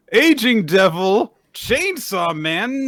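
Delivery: high, slow grand title call; announcer voice. Acted. Podcast host announcing the chapter title grandly.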